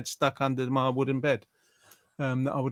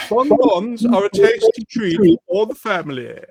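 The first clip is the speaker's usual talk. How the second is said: higher, louder, sales pitch read of Bonbons; cheesy announcer plugging sweets; mock advert voice; 8 semitones higher, 7 dB louder than usual. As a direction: sell it like a telly ad.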